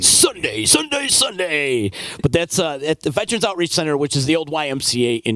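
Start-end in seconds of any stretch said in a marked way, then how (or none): 0.0-1.9 monster truck voice. Acted. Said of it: "my monster truck voice".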